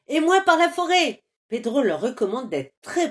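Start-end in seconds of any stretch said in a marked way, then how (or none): 0.1-1.2 high, loud call; shouting. Acted. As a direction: yell back the other way. Poursuite, réponse criée. (crie qu'il prend par la forêt)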